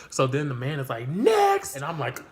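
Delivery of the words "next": high loud shout of next; calling out. Retold or imitated. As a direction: bark the call to the line.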